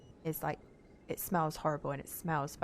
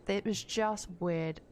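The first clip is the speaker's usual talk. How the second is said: normal talking voice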